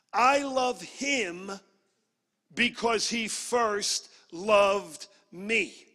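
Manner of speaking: slow, loud, word-by-word declaration; emphatic scripture quote. Real, not acted. Preacher giving the key verse to the church, slowly and emphatically. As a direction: let each word land, with pauses between.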